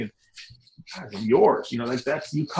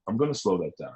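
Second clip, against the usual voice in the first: the same line again, lower and softer; a softer, lower echo of the line; quiet repeat; 6 semitones lower, 6 dB quieter, faster than usual. Shown as a demonstration. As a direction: let the repeat drop low and quiet.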